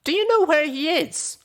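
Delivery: high and pressing; urgent high mare voice. Acted. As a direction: demand an answer fast. Urgent search for friend.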